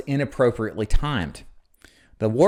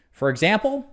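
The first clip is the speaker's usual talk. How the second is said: "For example" lifted high like a question; rising questioning inflection; 10 semitones higher than usual. Shown as a demonstration. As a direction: let the pitch climb through "For example". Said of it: "notice how my voice goes up".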